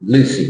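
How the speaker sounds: Lucy with LU stressed and -cy short, falling; Lucy, big then small; correct English stress. Shown as a demonstration. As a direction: hit LU in Lucy. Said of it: "ภาษาอังกฤษไม่ค่อยใช้".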